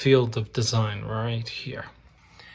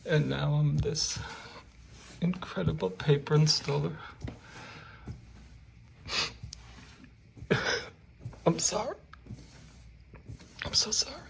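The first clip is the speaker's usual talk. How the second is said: high, wobbly pretend weeping; milk a fake cry of pride; mock tearful; 4 semitones higher than usual. Installer pretends to weep over his talent, high mock sob. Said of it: "I was kidding about the crying".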